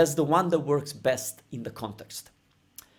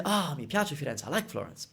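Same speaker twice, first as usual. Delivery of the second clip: soft musing; 8 dB quieter than usual. Acted. Visitor looking around Florence, soft musing to themselves. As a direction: sigh it out quietly to yourself. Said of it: "you look around and say".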